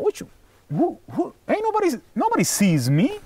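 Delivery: defensive protest. Retold or imitated. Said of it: "I'm like".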